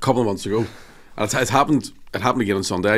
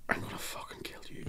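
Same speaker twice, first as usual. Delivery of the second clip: acted seething whisper, much softer; whispered threat; 16 dB quieter than usual. Uncle inwardly fuming at a kid at soft play, whispered threat. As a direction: threaten the kid under your breath in a whisper.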